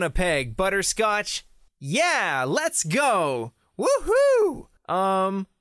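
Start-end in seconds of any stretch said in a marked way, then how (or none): 3.8-4.7 high gleeful woohoo shouted out; excited whoop. Acted. Knight whoops leaping at the monster, excited and high. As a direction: cheer like a kid charging in.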